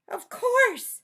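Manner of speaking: high, loud squeaky mouse; excited mouse voice. Acted. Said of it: "said the mouse".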